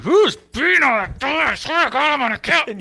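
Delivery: high, loud furious yell at the door; furious shout. Retold or imitated. Woken homeowner yelling at a drunk knocking, furious. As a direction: scream at whoever is pounding on your door. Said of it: "You'd be like".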